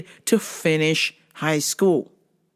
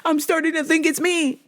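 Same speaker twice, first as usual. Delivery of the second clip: higher, lightly whiny; mock girl voice; 10 semitones higher than usual. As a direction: imitate the cousin doubting herself. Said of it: "I was like".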